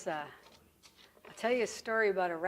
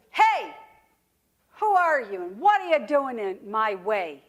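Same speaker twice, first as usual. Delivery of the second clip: loud, challenging snake voice; indignant snake; 8 dB louder than usual. Acted. Snake bumps into a rabbit in the forest, indignant.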